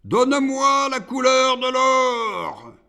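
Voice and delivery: loud, high-pitched howled demand; full-voiced demonic yell; demon's howl. A narrator's reading. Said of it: "Iblis hurla".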